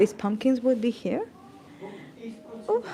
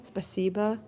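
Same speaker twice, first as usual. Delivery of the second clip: ordinary talk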